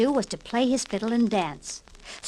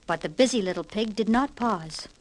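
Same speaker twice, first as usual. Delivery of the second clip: usual speaking voice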